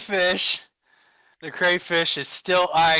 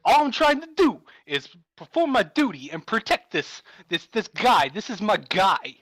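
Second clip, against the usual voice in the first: halting crayfish with swooping stresses; Christopher Walken; 3 semitones higher than usual. Acted. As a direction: plead your duty in a celebrity impression. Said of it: "I'm just full on Christopher Walken".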